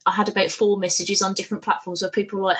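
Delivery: usual speaking voice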